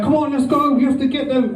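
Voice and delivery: high eager battle cry; rallying call. Retold or imitated. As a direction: urge the troops on.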